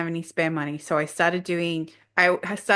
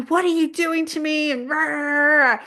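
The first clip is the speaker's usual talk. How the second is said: high, louder, imitating her own rant; hammed-up shouting at the sky; mock yelling; 9 semitones higher, 4 dB louder than usual. Retelling yelling at the universe, mock rant. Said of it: "yelling at the universe".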